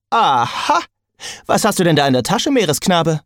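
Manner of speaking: fast, gleeful talking sword; triumphant nosy sword. A narrator's reading. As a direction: catch him out, gleeful and nosy. Sword Jack pounces on Percy.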